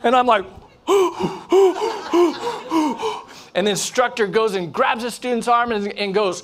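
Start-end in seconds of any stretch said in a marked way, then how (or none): 0.9-3.2 panicked gasping. Retold or imitated. Diver panicking right behind the student, mock panicked gasps. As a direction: act out hyperventilating in repeated bursts. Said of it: "I'm panicking right behind the student".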